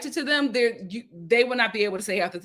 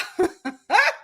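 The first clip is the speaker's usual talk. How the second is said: giggle; 9 semitones higher, 4 dB louder than usual. Retold or imitated. Giggle continues.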